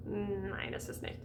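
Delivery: a mock German aside, quiet; playful German aside. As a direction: say nein, es ist nicht like a joking German. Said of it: "this isn't German".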